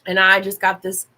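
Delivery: ordinary delivery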